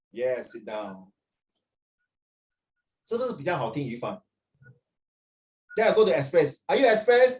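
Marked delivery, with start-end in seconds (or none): 0.1-1.1 lower, softer, slow brush-off; slow quiet teacher waving him off; dismissive teacher. Retold or imitated. Teacher dismisses student in skit. 6.7-7.4 higher and louder teacher question; teacher's pitched-up loud question; teacher asking. Retold or imitated. Teacher asks student in skit.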